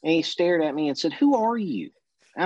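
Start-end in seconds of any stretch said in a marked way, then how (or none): 1.1-1.9 startled colonel. Retold or imitated. Colonel startled, retold question.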